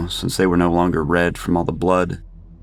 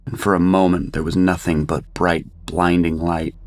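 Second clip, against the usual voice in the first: ordinary delivery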